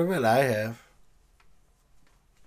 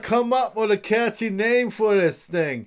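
pitchman voice; 6 semitones higher, 4 dB louder than usual. Pretend. Pitching the lox invention.

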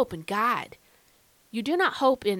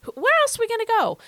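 much higher, louder and fast; excited Peter; 12 semitones higher, 5 dB louder, faster than usual. Retold or imitated. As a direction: cry it out in amazed devotion. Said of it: "what Peter said".

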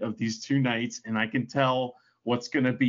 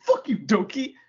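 imitated Italian-accented insult, pitched up; higher-pitched Italian accent imitation; mock Italian accent; 7 semitones higher than usual. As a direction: curse someone out in a put-on Italian accent. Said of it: "in his Italian accent".

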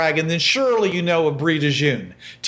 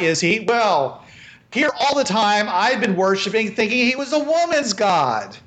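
mocking peasant woman; 2 semitones higher than usual. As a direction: tease him, drawing out "A man's god". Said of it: "you hear this female voice says".

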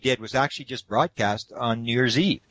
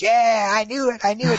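put-on much higher, louder excited boy; excited kid; 11 semitones higher, 6 dB louder than usual. Boy vindicated about aliens, excited high shout. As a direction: crow it out in triumph. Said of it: "He's all excited".

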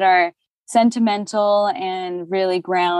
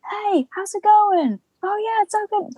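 much higher bright social voice; projected chirpy greeting; 13 semitones higher than usual. Shown as a demonstration. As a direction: pitch up high to greet over noise. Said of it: "even projecting my voice".

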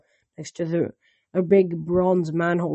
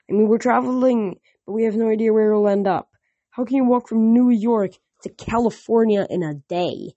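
put-on a bit higher, worried girl's complaint; fretting over an illogical quest; a hint of a frustrated teenage girl; lightly voiced Annabeth, complaining; 4 semitones higher, 3 dB louder than usual.

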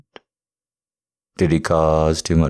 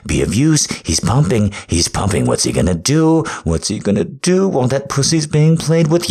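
louder and louder, pushing and urging; loud excited urging; 3 dB louder, faster than usual. Acted. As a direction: build to a driving, breathless crescendo. Building dominant commentary, loud.

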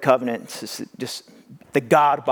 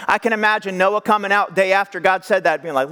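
normal talking voice

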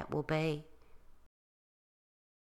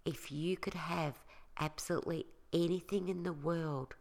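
soft spa whisper; 2 semitones lower, 7 dB quieter than usual. Acted. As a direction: talk them gently into calm, softer. Leads a guided relaxation.

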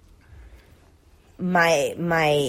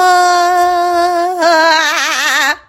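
high laugh; 12 semitones higher, 8 dB louder than usual. Real, not acted. Laughing after doll voice.

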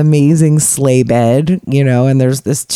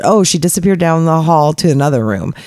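ordinary delivery

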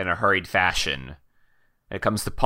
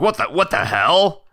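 higher, startled outburst; alarmed disbelief, pitched up; startled guard; 8 semitones higher, 4 dB louder than usual. Acted. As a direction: react in character as a startled guard. Guard sees the creature, startled.

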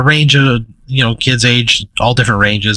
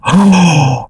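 gasp, then high drawn-out ooh; mock-amazed crowd of kids; awed kids; 7 semitones higher than usual. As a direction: imitate a roomful of amazed children. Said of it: "the kids all go".

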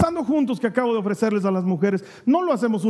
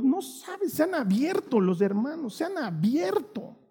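high, hushed amazement; 4 semitones higher, 6 dB quieter than usual. Real, not acted. Amazed report of group.

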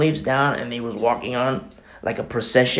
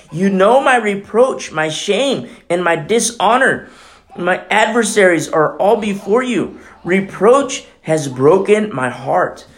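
voicing Christ's lament; 6 semitones higher, 4 dB louder, slower than usual.

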